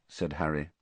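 usual speaking voice

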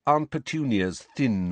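ordinary delivery, nothing marked

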